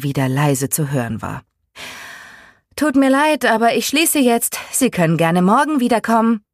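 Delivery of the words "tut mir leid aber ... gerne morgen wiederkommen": raised, much higher-pitched call. A narrator's reading. Missy to a late customer at closing, calling out. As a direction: call it out to someone across the room. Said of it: "rief sie, ohne aufzublicken".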